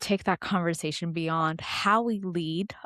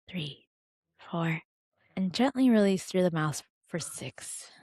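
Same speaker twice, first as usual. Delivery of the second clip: calm grounding exercise. Acted. Leads a breathing exercise.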